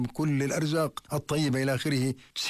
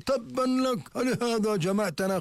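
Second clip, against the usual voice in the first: mock scornful jeer, pitched much higher; scornful retort; 8 semitones higher than usual. Quraysh mocking the Prophet's warning, higher scornful jeer. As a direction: fling it back with contempt, hammed-up. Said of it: "قالت قريش".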